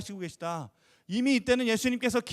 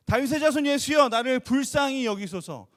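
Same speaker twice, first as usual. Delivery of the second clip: louder, higher pleading shout; louder cry; 4 semitones higher, 6 dB louder than usual. Retold or imitated. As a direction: cry out even louder for mercy. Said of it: "더 크게 외칩니다". (더 크게 높여 외치는 간청)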